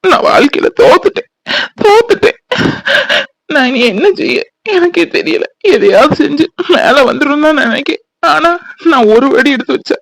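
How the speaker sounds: high, tearful voice breaking into sobs; crying. Acted. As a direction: sob out your despair to your wife, pitch climbing. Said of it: "ஏன்தா இதுக்கெல்லாம் அழுதுட்டு இருக்க". (அழுதுகொண்டே உயர்ந்த குரலில்)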